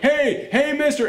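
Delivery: mock little girl voice calling mister. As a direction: play the eager kid calling the stranger.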